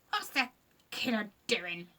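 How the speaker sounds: lightly voiced grumbling creaker; a hint of a grubby little monster; creature voice. Scratch the creaker puzzling over the crying girl, gruff. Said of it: "said Scratch".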